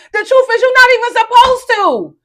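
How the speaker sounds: high, loud preacher's cry; preaching shout. Acted. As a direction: belt it out like a revival preacher. Repeats the line, now shouted.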